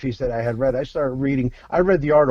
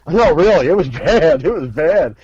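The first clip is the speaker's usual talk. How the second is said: loud, emphatic protest over laughter; real, amused insistence at raised volume; 2 semitones higher, 9 dB louder than usual. Real, not acted. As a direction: insist it loudly, leaning on BAD. Insisting after the listener laughs.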